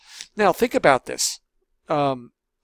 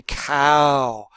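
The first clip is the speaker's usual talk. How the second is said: slow and careful. Shown as a demonstration. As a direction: draw out Cow slowly like to a toddler. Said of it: "And we say it very slowly".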